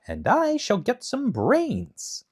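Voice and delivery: high, slow hopeful Scarecrow; lightly voiced hopeful Scarecrow. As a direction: stretch out a wish for brains, high and slow. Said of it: "said the Scarecrow".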